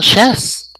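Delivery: high, loud character shout; [name]. Acted. Guest answering host's thanks, high loud character voice. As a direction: belt it out in a high put-on voice. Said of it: "Let's say hello to [name]".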